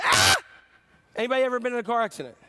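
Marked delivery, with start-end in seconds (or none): 0.0-0.4 very high loud scream; fright scream. Shown as a demonstration. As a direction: scream at an attacker. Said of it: "Somebody coming at you".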